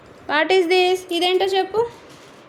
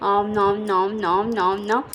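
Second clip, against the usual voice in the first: low playful nam-nam gulping sounds; mock drinking noises; 8 semitones lower, 3 dB quieter than usual. Shown as a demonstration. Mother showing her toddler how to drink milk, low playful nam nam. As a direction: smack out nam nam like a baby drinking. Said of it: "పాలు ఎలా తాగుతాం?".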